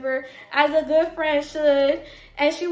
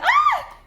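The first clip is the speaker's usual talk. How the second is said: sudden high yelp of pain; retold fall scream, loud and high; reenacted pained scream; 7 dB louder than usual. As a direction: shriek like you just hit the floor. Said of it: "y'all ever fall and be like".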